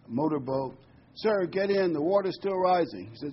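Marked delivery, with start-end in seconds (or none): 1.1-3.1 raised, higher urgent call; lightly voiced boatman shouting over rain; urgent rescuer. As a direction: hurry him in before the water rises. Second boat urging him.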